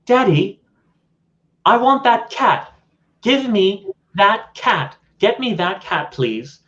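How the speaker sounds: put-on high whiny child's voice, slowing on the demands; spoiled little boy. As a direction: play a bratty kid demanding a pet from daddy. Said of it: "he looks out and he says".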